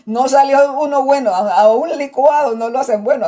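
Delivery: mock despair, loud and amused; exasperated sister. Sister complaining that her husband is hopeless, loud mock despair. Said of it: "así decía".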